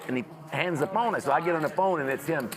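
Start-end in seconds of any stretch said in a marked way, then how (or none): none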